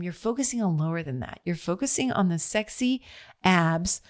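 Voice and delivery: ordinary delivery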